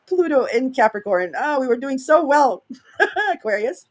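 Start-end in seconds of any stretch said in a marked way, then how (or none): 1.4-3.9 laughing. Real, not acted. Teasing Aquarius with a laugh.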